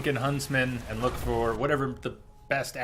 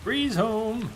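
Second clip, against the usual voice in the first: high, drawn-out happy exclamation; excited call-out; 11 semitones higher, 4 dB louder than usual. Real, not acted. Player greeting the house they spotted, excited call-out. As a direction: call it out high and a bit louder. Spots Breezehome and calls it out.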